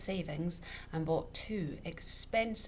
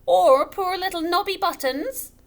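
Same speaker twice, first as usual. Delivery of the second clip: higher, louder indignant challenge; indignant widow; 13 semitones higher, 15 dB louder than usual. A narrator's reading. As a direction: throw the name back at him. Widow presses her point.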